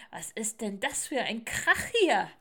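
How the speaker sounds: loud, nagging complaint, building; scolding beetle. Acted. Stag beetle complains about the racket, loud scolding. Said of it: "Hirschkäfer angekrabbelt und keifte".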